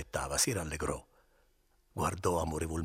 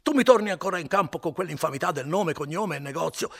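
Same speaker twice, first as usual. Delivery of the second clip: much higher and louder, railing; angry outburst; 12 semitones higher, 9 dB louder than usual. Acted. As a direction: hurl the accusation at him. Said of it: "esclamò Renzo".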